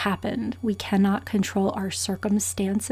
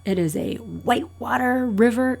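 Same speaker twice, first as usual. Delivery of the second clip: ordinary delivery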